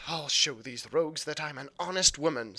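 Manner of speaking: firm declaration of her honesty; proud, defiant mother. Acted. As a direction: stand on her pride.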